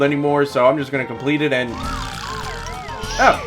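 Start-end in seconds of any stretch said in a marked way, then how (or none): none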